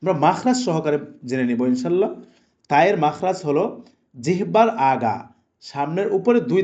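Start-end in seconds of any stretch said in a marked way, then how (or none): none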